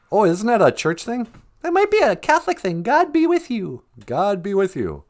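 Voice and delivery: very high, small comic voice; high-voiced sidekick. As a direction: pipe up as the host's curious sidekick. Sidekick figure beside host.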